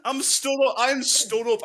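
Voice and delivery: high-pitched exasperated protest; worked up, angry. Real, not acted. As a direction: protest as if jumping out of your seat. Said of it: "I am so angry".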